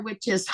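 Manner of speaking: loud, high roaring laugh; lion's roar laugh. Shown as a demonstration. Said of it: "you do a lion's roar".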